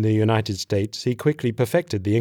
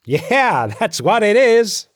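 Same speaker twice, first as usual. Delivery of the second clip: high, loud, drawn-out shout; shouting back; 11 semitones higher, 6 dB louder, slower than usual. A narrator's reading. Soldier answers his mate from afar, shouting back. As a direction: holler it back, stretching the words. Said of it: "the other shouted back".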